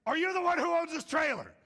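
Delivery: loud, high confrontational yell; angry big dude. Retold or imitated. Monstrous dude confronting him over a trailer, angry shout. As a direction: bellow the question as an imitated tough guy. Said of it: "big, monstrous dude shows up".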